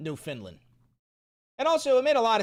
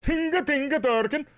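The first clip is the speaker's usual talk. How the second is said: high, sing-out cartoon holler; silly catchphrase cry; 6 semitones higher, 3 dB louder than usual. Mock SpongeBob catchphrase outburst.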